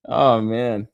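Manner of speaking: usual speaking voice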